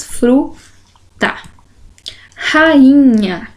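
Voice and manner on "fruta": "fruta" split into fru, pause, ta; sílaba por sílaba. Shown as a demonstration. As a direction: sound out "fruta" in two syllables. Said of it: "tem duas sílabas". ("fruta" separado em duas sílabas)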